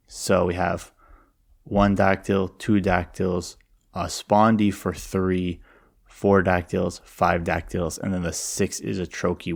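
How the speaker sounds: ordinary delivery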